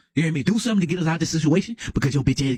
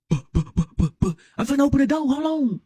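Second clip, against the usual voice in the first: put-on slow, higher girl's voice through the door; voicing the girl; 7 semitones higher, much slower than usual. Girl calling through the door, higher and slow. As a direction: play the girl calling out slowly.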